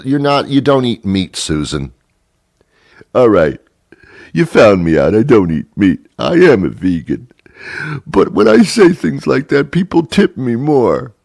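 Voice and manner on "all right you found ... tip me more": louder, higher waitress voice; confessing waitress. Retold or imitated. Waitress Susan admitting to the customer, loud and higher.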